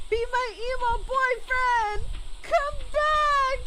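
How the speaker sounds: desperate high shouts at a fleeing character; pleading shout. Real, not acted. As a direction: yell after him, begging him back. Begging game character to return.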